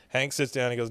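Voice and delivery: ordinary delivery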